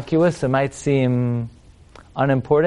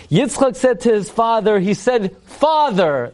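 raised, portentous call to father; mock-theatrical declamation; 5 semitones higher, slower than usual. Rabbi mocking Isaac addressing his father, mock-theatrical. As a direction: announce it with hammy gravity. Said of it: "what's the meaningful conversation over here?".

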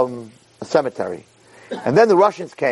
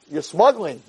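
normal talking voice

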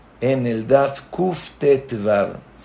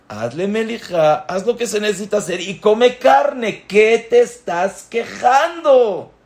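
higher and higher, building to an exasperated challenge; rising exasperation; 7 semitones higher than usual. Acted. Rabbi challenging people who complain about meat, exasperated. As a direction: scold them with mounting disbelief.

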